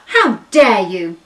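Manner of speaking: loud, slow, outraged ostrich; slow furious how dare you; indignant shout. Acted. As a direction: bellow it, deeply offended. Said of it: "and shouted at the baby elephant".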